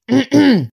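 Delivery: loud, high haughty ahem; pompous throat clear. A narrator's reading. Éléphant interpelle Castor sur son toit, raclement de gorge pompeux. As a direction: clear the throat to announce yourself. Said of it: "fit Éléphant".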